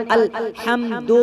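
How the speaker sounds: अल्हम्दु with ह from mid-throat, slow. Shown as a demonstration. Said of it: "हलक के बीच से हा निकलता".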